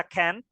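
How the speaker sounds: "can't" with the American short e-like vowel. Shown as a demonstration. Teacher showing students the American reading, "can't" as "kent". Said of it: "itu pronunciation-nya orang Amerika".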